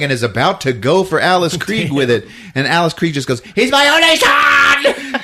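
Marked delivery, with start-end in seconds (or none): 3.5-5.2 high, loud, slow cry; mother crying out for her son, high and slow; anguished shout. Acted. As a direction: howl it as a grieving mother. Mother attacks.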